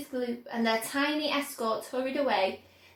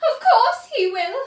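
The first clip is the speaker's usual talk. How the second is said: much higher and louder, hysterical shriek; 11 semitones higher, 11 dB louder than usual. A narrator's reading. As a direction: shriek back at him. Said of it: "shrieked Missus Gloop".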